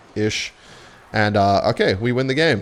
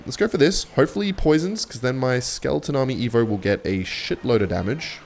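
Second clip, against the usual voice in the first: ordinary delivery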